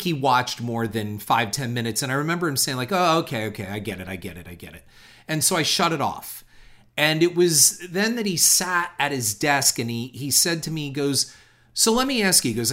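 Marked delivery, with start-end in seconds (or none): 2.9-4.8 dismissive brush-off. Retold or imitated. Producer dismissing the reel. 11.7-12.5 higher, louder pointed opener; a touch of an agitated boss grilling; agitated producer. Retold or imitated. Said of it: "very agitated all the time".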